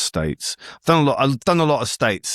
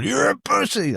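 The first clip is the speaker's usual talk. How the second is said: high mock taunt; 11 semitones higher than usual. Rednecks jeer from a distance.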